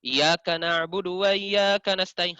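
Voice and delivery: "Iyyaka" chanted as "yaka"; hamzah lost. Shown as a demonstration. Teacher imitates faulty readers, hamzah lost. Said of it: "Beda kalau orang baca seperti ini".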